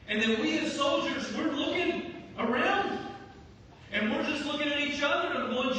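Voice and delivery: loud, high, fervent sermon delivery; full-force preaching. Acted. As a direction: preach it out to the whole room.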